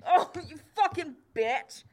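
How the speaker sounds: high-pitched wounded cursing; acted pain. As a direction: yelp and curse after a blow to the nose. Jailer hit in the nose.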